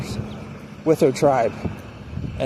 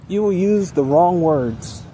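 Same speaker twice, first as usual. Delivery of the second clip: usual speaking voice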